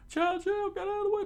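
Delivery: very high falsetto cry; pleading townspeople. Acted. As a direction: urge the dog aside in a squeal.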